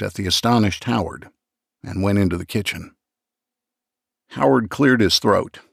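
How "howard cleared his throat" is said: ordinary delivery, nothing marked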